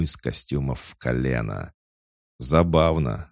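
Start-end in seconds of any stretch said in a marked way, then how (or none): none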